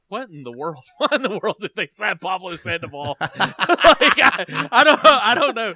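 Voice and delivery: loud, laughing disbelief; helpless laughter breaking through the words; laughing bafflement. Real, not acted. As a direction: crack up while puzzling it out. Baffled by signing, cracking up.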